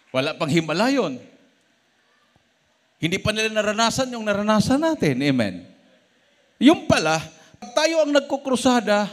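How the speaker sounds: loud, high, driving sermon voice; fiery preaching. Acted. Preacher stirring the church with crusade testimony, loud and high. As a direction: preach it out to the congregation. Preacher rising to full force.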